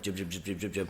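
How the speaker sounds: low, soft rattle of clipped syllables; imitating fast talkers. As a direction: mimic unintelligible quick Irish chatter under your breath. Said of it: "We speak faster".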